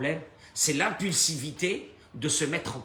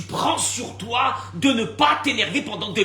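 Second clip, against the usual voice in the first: louder, higher preaching outburst; emphatic shout; 7 semitones higher, 6 dB louder than usual. Real, not acted. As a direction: drive the rule home at full volume. Preacher stressing the rule. (le prédicateur martèle la règle en criant)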